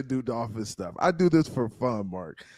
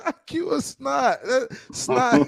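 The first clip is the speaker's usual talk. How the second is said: laughing; 9 semitones higher, much slower than usual. Real, not acted. Mocking the insult, laughing.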